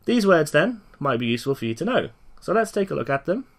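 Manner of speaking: ordinary delivery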